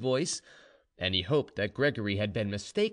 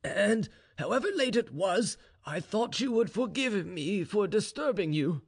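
higher-pitched, hesitant father; lightly dramatised, grave general; earnest general; 10 semitones higher than usual. A narrator's reading. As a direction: broach a serious matter with your daughter. General addresses his daughter.

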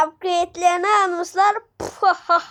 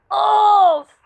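pained groan; 3 semitones higher, 5 dB louder than usual. Real, not acted. Character gets hit.

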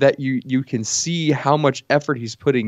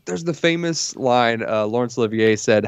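usual speaking voice

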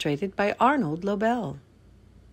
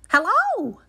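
very high, cheery little Frog voice; Frog, greeting; 14 semitones higher, 4 dB louder than usual. A narrator's reading. Frog greeting Toad at the door, high-pitched. Reads Frog's line in story.

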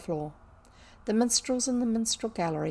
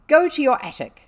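loud, higher-pitched angry order; commanding; 7 semitones higher, 12 dB louder than usual. A narrator's reading. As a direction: send the servant off sharply. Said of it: "she commanded".

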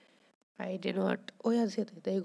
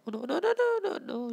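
high, loud panicked cry; 12 semitones higher, 5 dB louder, faster than usual. Real, not acted. Gamer watching a fish escape, high panicked yelp. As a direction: yelp it as the fish slips away.

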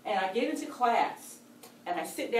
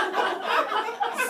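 high laugh; 14 semitones higher, 5 dB louder than usual. Real, not acted. Laughs after the story.

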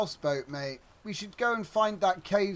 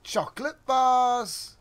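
excited; 7 semitones higher, 6 dB louder, slower than usual. Real, not acted. Excited find.

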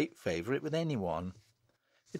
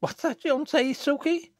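very high-pitched challenge; sharp cry; 4 dB louder than usual. A narrator's reading. Jennet catching Susan's muttered insult, crying out. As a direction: demand an answer, voice shooting up. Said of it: "cried Jennet".

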